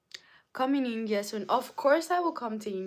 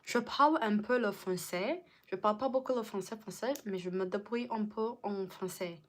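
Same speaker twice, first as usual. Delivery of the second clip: French with an American English accent; faster than usual. Shown as a demonstration. Showing viewers an accent, American-accented French. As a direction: speak French like an American learner. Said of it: "American French accent".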